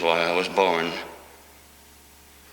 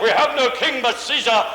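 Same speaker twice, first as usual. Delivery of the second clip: much higher, louder shout; crowd yelling; 5 dB louder than usual. Acted. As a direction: hurl it back at Pilate as the mob. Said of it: "you yelled out".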